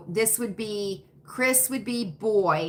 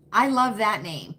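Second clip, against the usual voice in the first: usual speaking voice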